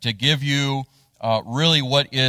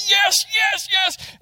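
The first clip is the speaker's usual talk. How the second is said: triumphant yes; slower than usual. Retold or imitated. Dad pumping his arms at the launch, ecstatic yelling. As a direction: cheer it like a touchdown. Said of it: "he was pumping his arms".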